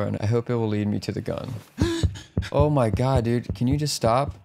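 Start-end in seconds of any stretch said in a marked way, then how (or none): 1.6-2.5 startled gasp of fright; sharp gasp. Real, not acted. Jump scare in horror game. 2.5-4.3 shaken, louder complaint after a scare; startled outburst. Real, not acted. Reacting to jump scare.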